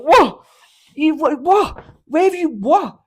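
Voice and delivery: high, loud baffled what; baffled mates. Retold or imitated. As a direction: shout the confusion at a friend. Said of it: "the boys are going".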